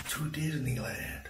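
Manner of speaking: hushed secret whisper. Real, not acted. Vlogger to viewers, whispering the big reveal. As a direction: breathe the name like a secret.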